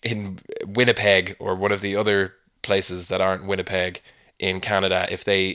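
usual speaking voice

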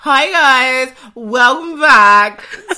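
loud, drawn-out mock greeting; mock show intro. Host jokingly opens the show to listeners, loud and drawn out. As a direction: belt out an over-the-top podcast welcome.

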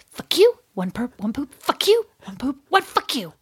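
put-on whispery, squeaky mutter; spiteful dog voice. Dog going round leaving poo, put-on spiteful voice. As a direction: imitate a dog spitefully pooping as it goes. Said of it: "she was going".

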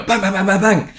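rapid high mock gunfire; cartoon shooting noises; imitated gunfire. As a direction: fire off the bangs like a gun.

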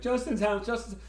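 put-on high, excited little-kid voice; imitating a small child. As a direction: chirp it like a toddler asking for his show. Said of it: "he's like".

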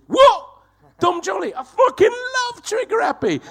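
very high, loud starstruck yell; astonished fan. Retold or imitated. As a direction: imitate a man suddenly thrilled to bits. Retold cabbie recognises his hero.

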